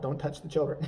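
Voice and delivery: usual speaking voice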